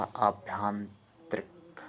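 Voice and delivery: "अभ्यंतरिक" drawn out with a long opening aa; long first vowel. Shown as a demonstration. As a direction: slowly stretch "अभ्यंतरिक" as aabhyantarik. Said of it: "बड़ा आ होता". ("अभ्यंतरिक" बड़े आ से, धीरे)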